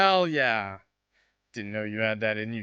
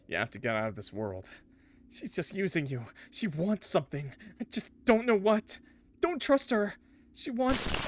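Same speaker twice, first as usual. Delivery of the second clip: much higher pleading voice, urgent; desperate trapped character; 7 semitones higher than usual. Acted. Trapped character begging the player to log off, pleading high. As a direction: plead to escape before deletion.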